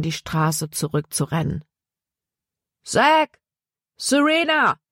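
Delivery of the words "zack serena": high, urgent call by name. A narrator's reading. Paul runs back for the two children, calling out. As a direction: call across a distance, pitched way up. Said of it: "rief er".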